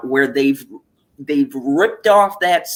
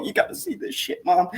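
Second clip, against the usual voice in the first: hushed aside to mom; 4 semitones higher, 6 dB quieter than usual. Retold or imitated. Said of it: "I go".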